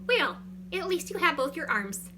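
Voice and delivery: high, loud, hammed-up Pollyanna cheer; mock consolation. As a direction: patronise them with put-on brightness. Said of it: "reminding them that they have both their arms".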